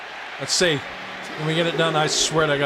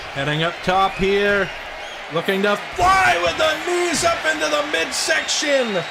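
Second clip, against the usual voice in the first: shouted play-by-play; 8 semitones higher than usual. Acted. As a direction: scream the near falls like a TV announcer. Commentary at full force.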